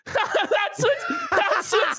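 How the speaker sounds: high, gleeful taunt broken by laughter; laughing mockery. Real, not acted. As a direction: crack up while rubbing it in. Gleeful mocking, laughing through words.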